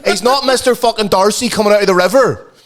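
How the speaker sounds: high, indignant comic protest; exasperated rant. Retold or imitated. Son jealous of ma fussing over his mate, comic outrage.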